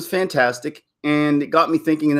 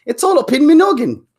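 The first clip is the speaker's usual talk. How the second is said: high, loud goofy character voice; playful put-on voice; 5 dB louder than usual. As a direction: jump into a silly voice for a joke. Jokey put-on line, dialect "me.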